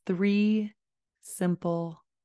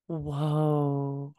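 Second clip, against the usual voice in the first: slow low calm; 4 semitones lower than usual. Shown as a demonstration. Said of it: "let my tone and my voice ... be slow and low".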